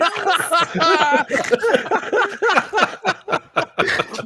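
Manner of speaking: long, high real laughter; helpless laughing fit. Real, not acted. Host cracking up at Scotty's Doritos joke, helpless laughter. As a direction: crack up uncontrollably until it hurts the nose. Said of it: "you're making me snot".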